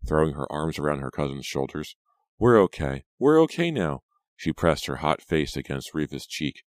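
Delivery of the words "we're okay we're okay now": high, loud, rising to higher on the repeat; acted relieved outburst; emotional relief. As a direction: exclaim it in relief, building. Pam relieved, hugging her cousin.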